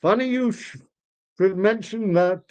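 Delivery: high, swooping lament; lamenting old man. Acted. Old father telling friend his son converted, lamenting. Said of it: "old Mister Zadelman".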